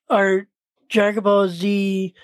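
ordinary talk

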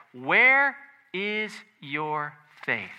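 very slow, word by word; slow, weighty questioning of the disciples; measured rebuke. Retold or imitated. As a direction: space out each word as a solemn challenge. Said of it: "Jesus rebuked them".

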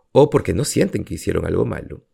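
ordinary talk, nothing marked

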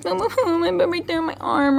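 put-on high toddler voice, pouting. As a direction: whine it like a little kid showing a hurt arm. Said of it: "Toddlers might point and pout".

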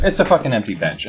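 ordinary talk, nothing marked

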